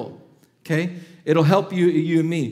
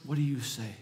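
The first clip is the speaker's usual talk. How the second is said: hushed, earnest prayer to the Father; a soft, intimate prayer voice; quiet prayer; 2 semitones lower, 3 dB quieter, faster than usual. Acted. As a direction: pray it softly and sincerely. Models a personal prayer.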